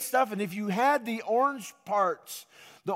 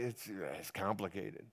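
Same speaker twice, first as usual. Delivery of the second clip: much lower and softer, weary mumble; eye-rolling sigh; 12 semitones lower, 14 dB quieter than usual. Retold or imitated. Friend asked how it's going, weary eye-roll mumble. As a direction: sound fed up and done explaining. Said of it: "roll their eyes and say".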